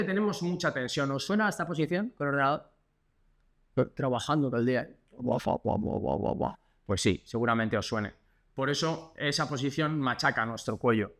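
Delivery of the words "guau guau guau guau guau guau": unvoiced huffed guau guau; breathy mock barking. Physio mocking the hunched office posture with breathy barks. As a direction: puff out the syllables like a tired dog. Imitates working posture sound.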